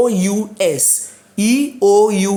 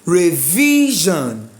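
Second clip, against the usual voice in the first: teacher's drawn-out VI in Revision; stress on VI; much slower than usual. Shown as a demonstration. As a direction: slowly stretch the middle of Revision. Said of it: "The stress must be second to last".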